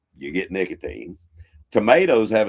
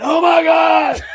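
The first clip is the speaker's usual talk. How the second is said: high, drawn-out panicked-style scream; re-enacted loud, high shriek; 12 dB louder, slower than usual. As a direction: scream it at full volume. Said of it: "just started screaming".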